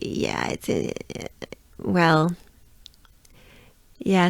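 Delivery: usual speaking voice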